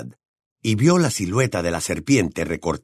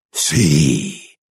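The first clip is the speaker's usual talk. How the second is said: low, rough serpent voice; sinister whispering voice; 8 semitones lower, 4 dB louder than usual. A narrator's reading. Unseen voice over the pinned boy, low sinister murmur. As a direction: stretch it out low and menacing. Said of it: "susurró la voz".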